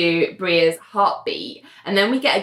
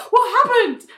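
high-pitched startled cry; acted confused panic on waking; 4 dB louder than usual. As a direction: jolt awake in mock alarm. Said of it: "Bria wakes up going".

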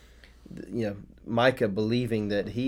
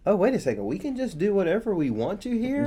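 sudden realization; 7 semitones higher than usual. Acted. Danites realizing they can do whatever they want, high and gleeful. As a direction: play the Danites cheerfully spotting a loophole. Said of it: "it's kind of like they realize".

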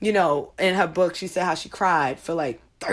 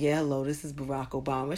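deep, soft phone greeting; Barack Obama; 6 semitones lower, 8 dB quieter than usual. Retold or imitated. He answers his supervisor's phone call, imitated deep voice. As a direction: drop low for an imitated deep voice. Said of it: "he's got that deep voice".

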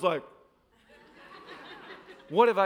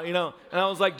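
normal talking voice

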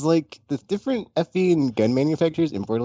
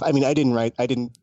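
usual speaking voice

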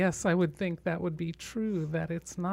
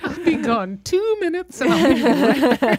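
high, loud playful mommy voice; sing-song mom promising to hurry back; a mother snake calling to her eggs, high and loud; mock mommy snake; 9 semitones higher, 13 dB louder than usual.

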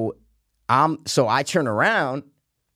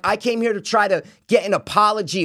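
mild mock yell, higher; screaming back; 7 semitones higher than usual. Golfer demanding apology in shouting match, exasperated. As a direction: protest over the shouting, pitched up. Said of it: "we're screaming back and forth".

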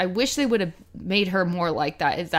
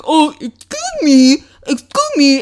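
raised, slow whiny "excuse me"; whiny mock complaint; 7 semitones higher, 8 dB louder than usual. Anakin whining at Padme, slow and raised. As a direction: whine it like a sulking apprentice. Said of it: "he gets all whiny".

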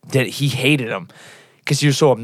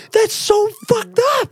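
appalled; slower than usual. Retold or imitated. Retold disgust.

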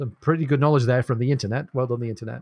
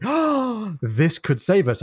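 overjoyed; 6 semitones higher, much slower than usual. Acted. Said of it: "meanwhile, is overjoyed that they're saved".

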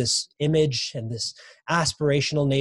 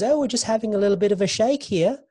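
lightly voiced calm leader, raised and airy; a hint of an unbothered prime minister; breezy impression; 9 semitones higher than usual. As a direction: breeze through it like nothing's wrong. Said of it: "She says".

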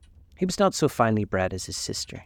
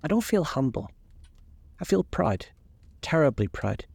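put-on a lightly raised, proud girlish voice; young Sybil; 6 semitones higher than usual. A narrator's reading. Sybil telling her mother she feels proud, a light girlish lift. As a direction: Sybil glowing with pride in her love.

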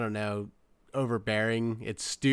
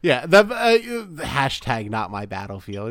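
ordinary talk, nothing marked

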